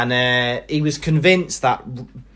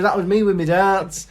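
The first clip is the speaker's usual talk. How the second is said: put-on proud drunk; 8 semitones higher than usual. Drunk man boasting about dart holes, high and laughing. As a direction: show off like a tipsy lad.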